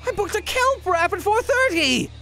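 whining outrage at being sent back to work; indignant. Acted. Protesting an immediate new mission.

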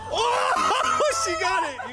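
whooping laugh running into the words; laughing in surprise. Real, not acted. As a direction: crack up in delighted surprise. Daughter guesses his exact age.

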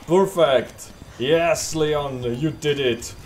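loud, high, happy cheer; excited cheering. Real, not acted. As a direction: cheer the hero on. Cheering after the escape.

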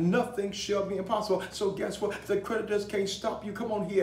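impassioned rallying of the congregation; full-force preaching. Real, not acted. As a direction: preach it hard, punching each phrase.

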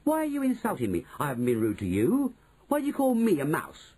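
put-on high-pitched, affronted boy; offended, pitched well up; indignant protest. A narrator's reading. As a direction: protest as if grossly insulted. Bruno protests at being insulted.

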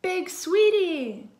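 high, loud, drawn-out cry; excited shout. Acted. Children spot the Big Sweetie sculpture, excited shout. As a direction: stretch it into a delighted holler.

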